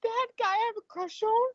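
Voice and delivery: put-on high, hushed girlish voice; teen daughter voice. Daughter confiding about her crush, high and hushed. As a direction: lift into a hushed teen girl.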